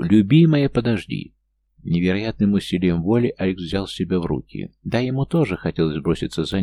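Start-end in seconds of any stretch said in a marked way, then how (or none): 0.0-1.3 shouted call while running; shouting. Acted. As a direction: call out after her, loud. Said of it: "крича на бегу". (кричит на бегу)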